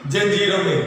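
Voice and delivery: ordinary talk, nothing marked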